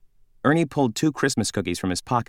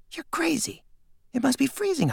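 put-on young boy voice. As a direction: play the sleepy friend, high and hushed. Nate answers in bed.

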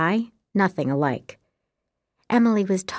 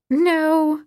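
laughing; 9 semitones higher, 4 dB louder than usual. A narrator's reading. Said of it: "Emily laughed".

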